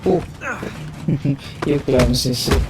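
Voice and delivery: normal talking voice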